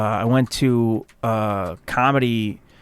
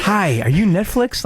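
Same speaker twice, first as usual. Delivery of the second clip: higher and slow, fawning question; mock eager comic; 7 semitones higher than usual. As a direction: sound desperate to impress. Mocking his eager showcase self.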